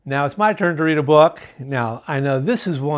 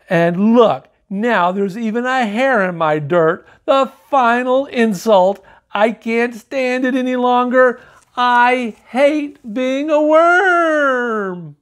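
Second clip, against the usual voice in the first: climbing higher, ending slow and drawn-out; little worm's final outburst, ending in a slow wail; 7 semitones higher than usual. Acted. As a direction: build to a wailing climax. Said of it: "exhausted by his outburst".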